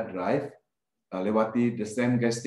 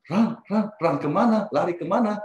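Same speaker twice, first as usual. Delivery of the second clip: high, louder, urgent questioning; imitated bewilderment; 8 semitones higher, 4 dB louder than usual. Narrator answers the sudden voice, bewildered. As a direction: throw the command back, baffled.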